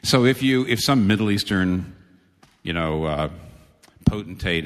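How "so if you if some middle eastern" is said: usual speaking voice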